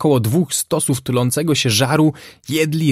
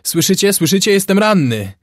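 high, insistent yell; 9 semitones higher, 4 dB louder than usual. A narrator's reading. Wounded sailor yells up to his comrades for help. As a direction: keep yelling high to be heard. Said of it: "wrzasnął marynarz".

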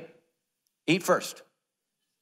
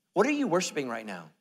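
ordinary talk

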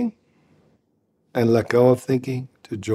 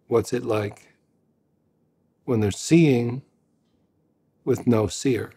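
a calm meditation teacher's guiding voice; guided meditation. Acted. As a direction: lead them gently into just hearing.

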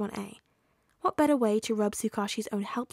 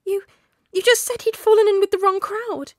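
high, loud protest from a distraught mother; shrill accusation; 10 semitones higher, 11 dB louder than usual. A narrator's reading. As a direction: cry it out shrilly. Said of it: "Inko asked shrilly".

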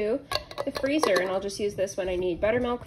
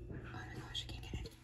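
barely audible whisper; real hushed, voiceless aside; muttering to herself; 19 dB quieter than usual. Real, not acted. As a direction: whisper it under your breath while struggling.

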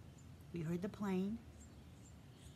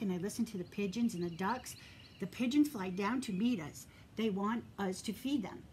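plain reading voice

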